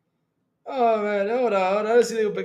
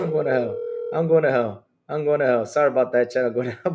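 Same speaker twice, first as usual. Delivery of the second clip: ordinary delivery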